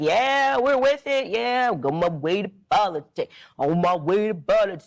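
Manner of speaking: high, loud mock rap voice; mock hype-man chant; mocking imitation. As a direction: ham up a mock rapper hyping politics.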